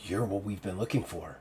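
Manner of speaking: soft, awed realization; hushed revelation. Acted. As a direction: say it low, as a discovery. Siggy reveals who he is.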